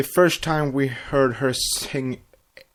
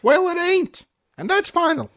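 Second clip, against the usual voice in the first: very high, loud insistent voice; reading a pony's stubborn line; stubborn cartoon pony; 5 dB louder than usual. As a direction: squeak it out as final word.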